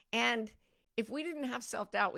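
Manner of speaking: usual speaking voice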